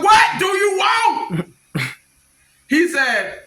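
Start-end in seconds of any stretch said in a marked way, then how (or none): 0.0-1.5 slow, high shouted demand; shouting through the door at a stranger; angry shout. Retold or imitated. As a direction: yell it at him, drawn out and high. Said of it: "I'm just going off".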